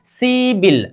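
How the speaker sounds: "सिबिल" as a long see, then bil; correct pronunciation. Shown as a demonstration. Teacher models the changed sound for students, "सिबिल" pronounced with b. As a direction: say "सिबिल" with b in place of p. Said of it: "उच्चारण कीजिए".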